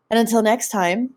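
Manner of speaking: podcast host outro; show sign-off. Acted. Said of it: "signing off the show".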